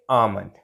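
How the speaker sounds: आमंड with silent L, low falling tune; correct almond pronunciation. Shown as a demonstration. Teacher answering own question for learners, silent L. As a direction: drop the pitch and say आमंड without L. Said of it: "correct pronunciation क्या होगी".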